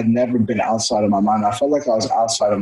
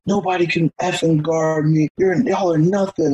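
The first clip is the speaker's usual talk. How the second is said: higher-pitched boastful trash talk; mock trash talk; 7 semitones higher than usual. Player trash-talking teammates after each goal, higher boastful taunt. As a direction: gloat over the whole team.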